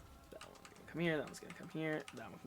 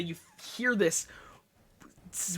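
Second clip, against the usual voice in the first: usual speaking voice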